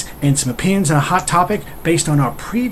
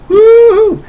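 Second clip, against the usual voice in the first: very high-pitched sung-out woo-hoo; gleeful high "woo-hoo"; high loud whoop; 8 dB louder than usual. Pretend. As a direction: let out a loud, held falsetto whoop. Whoops over sparkles.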